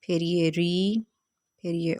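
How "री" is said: री drawn out on its own; isolated syllable. Shown as a demonstration. Teacher points out the next syllable, री held slowly by itself. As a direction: say री slowly as a separate beat. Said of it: "उस तरह से बोलना one by one".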